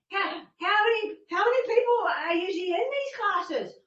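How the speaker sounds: high, shaky-nerves question; nervous stammer. Retold or imitated. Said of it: "my hands were shaking".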